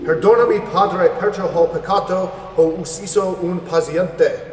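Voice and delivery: high, loud call, slowing on the last line; loud Italian plea, slowing at the end; loud Italian confession. Acted. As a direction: project the confession into the dark hall. Same confession repeated in Italian.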